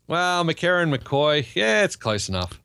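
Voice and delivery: hammed-up Ocker Australian twang; mock Ocker accent. Host brushes off a name correction, mock Ocker accent. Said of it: "figured out how to do the accent".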